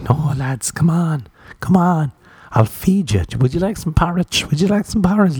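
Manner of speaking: high-pitched, wheedling appeal to the cops; pleading. Acted. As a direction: beg them in a high coaxing voice. Acted pleading with cops not to deport.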